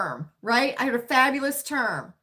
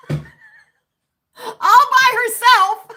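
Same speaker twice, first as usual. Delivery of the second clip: high squealing laugh through the words; cracking up while speaking; 13 semitones higher, 10 dB louder, much slower than usual. Real, not acted. Laughing at honey's decision.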